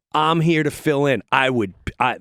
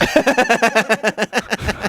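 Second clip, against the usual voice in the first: high burst of laughter; laughing at his own panic; real laugh; 6 semitones higher than usual. Real, not acted. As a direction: break into laughing.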